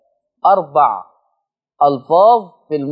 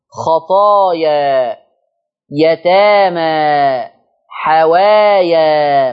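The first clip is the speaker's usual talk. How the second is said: drawn-out words; 3 dB louder, much slower than usual. Shown as a demonstration. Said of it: "الألفاظ دي على النحو التالي".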